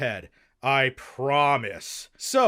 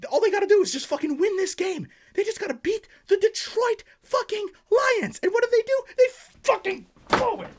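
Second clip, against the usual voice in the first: very high, louder, furious shrieking; screaming rant; 4 dB louder than usual. Acted. Vlogger to viewers about the Packers loss, shrieking fury. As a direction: explode at the Packers blowing it. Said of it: "Sorry for that rant earlier".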